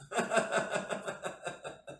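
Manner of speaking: long unvoiced chuckle; laugh. Real, not acted. Teacher joking about seeing the son, long chuckle. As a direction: laugh quietly at your own joke.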